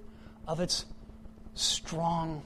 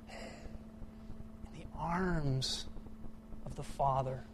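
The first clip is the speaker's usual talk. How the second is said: much softer and very slow; tender; 8 dB quieter, slower than usual. Acted. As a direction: let it settle gently. Tender sermon moment.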